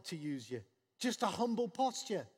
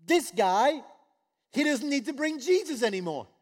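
higher and louder, excited urgency; eager servants; 7 semitones higher, 9 dB louder than usual. Retold or imitated. Servants hurrying to tell their master, excited. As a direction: rush off with good news. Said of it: "his servant's going".

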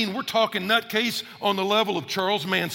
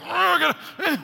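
suddenly thrown, blurting it out; flustered; 9 semitones higher, faster than usual. Retold or imitated. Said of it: "when you're kind of flustered".

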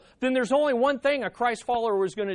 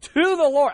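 "To the Lord" loud and raised in pitch; "To the Lord" as a forceful declaration; emphatic TO THE LORD; 6 semitones higher, 7 dB louder than usual. Shown as a demonstration. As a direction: drive home "To the Lord". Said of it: "I like the emphasis of that".

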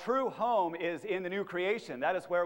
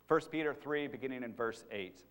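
ordinary talk

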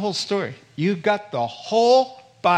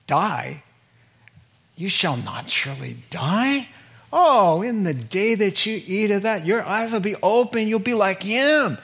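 tempter's lie; 5 semitones higher than usual. Retold or imitated. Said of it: "the lie comes back".

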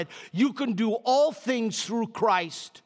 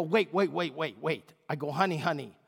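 remorseful apology; 5 semitones lower than usual. Retold or imitated. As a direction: plead sorry to your wife.

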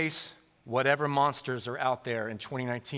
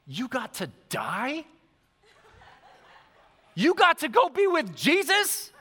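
put-on excited little boy; 10 semitones higher than usual. Son asking his sister about the dream, thrilled high voice. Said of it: "Wyatt says".

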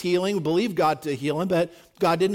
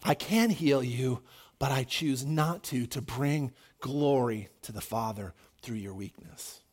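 God telling Paul, quiet and low; 5 semitones lower, 6 dB quieter than usual. Acted. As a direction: gently speak as God, low. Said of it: "God said to Paul".

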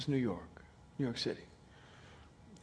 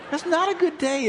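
louder, higher, through a laugh; laughing repeat; 11 semitones higher, 7 dB louder than usual. Shown as a demonstration. Preacher repeating his joke to the congregation, laughing. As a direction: land the joke again, chuckling.

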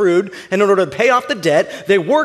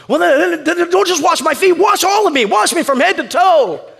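high, loud, stammering rush of eagerness; comically fervent outburst; over-eager Peter; 11 semitones higher, 5 dB louder than usual. Acted. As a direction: burst out pleading, pitched way up. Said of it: "And next Peter goes".

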